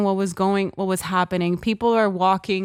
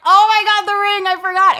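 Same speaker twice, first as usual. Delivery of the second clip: very high, loud retold squeal; excited realization; 11 semitones higher, 7 dB louder than usual. Woman suddenly remembering the ring as box opens, excited squeal. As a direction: cry out high in delighted shock. Re-enacts seeing the forgotten ring.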